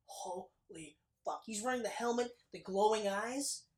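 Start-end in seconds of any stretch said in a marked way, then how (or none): 0.1-1.4 slow, soft, stunned "holy fuck"; stunned moviegoer, slow and hushed; awed hush. Retold or imitated. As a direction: let it fall out of you in disbelief. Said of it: "We were like".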